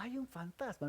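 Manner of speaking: a half-hearted mock shout; soft, limp cry. Shown as a demonstration. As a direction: show how a man does NOT shout. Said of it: "Los hombres no gritan así despacio".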